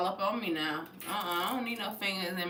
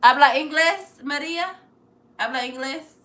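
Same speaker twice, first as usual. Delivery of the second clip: loud question; 4 semitones higher, 8 dB louder than usual. Real, not acted. Asking viewer if she speaks English.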